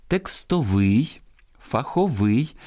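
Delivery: текстовий, фаховий stressed on final -вий; stress on last syllable. Shown as a demonstration. Host models correct forms, rising final-syllable stress on текстовий, фаховий. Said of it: "я зараз правильно читаю".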